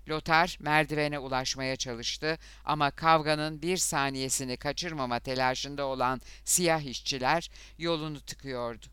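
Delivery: ordinary delivery, nothing marked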